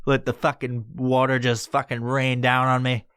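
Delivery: ordinary talk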